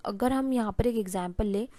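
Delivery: usual speaking voice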